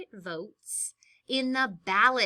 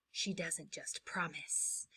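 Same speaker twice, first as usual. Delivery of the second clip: soft hushed slogan; whispered ad tagline; 4 semitones lower, 4 dB quieter than usual. Acted. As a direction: breathe it like a secret promise. Campaign ad tagline.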